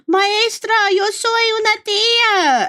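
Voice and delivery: put-on high, loud, slow child's announcement; proud little kid. Little girl proudly telling her Spanish teacher she's an aunt. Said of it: "I remember telling my teacher".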